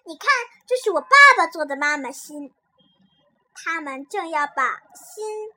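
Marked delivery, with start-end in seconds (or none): 0.1-2.5 put-on loud boastful child showing off; proud, pleased. A narrator's reading. A-Zhi showing Little Apple his father's heart, loudly proud. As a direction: show it off proudly, much louder. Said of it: "他很得意地对小苹果说".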